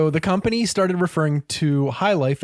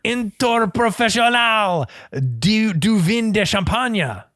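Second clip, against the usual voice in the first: hammed-up French accent, slow; french chef; 5 semitones higher than usual. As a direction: roll out the French with flair. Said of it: "Sacre bleu".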